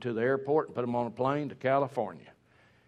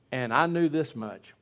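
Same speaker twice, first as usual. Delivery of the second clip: ordinary talk, nothing marked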